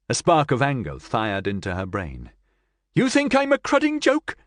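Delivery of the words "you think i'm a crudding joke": high, loud furious shout; angry shout. Acted. Cassandra to amused Nigel, high furious shout. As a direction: lash out in offended rage. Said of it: "she shouted".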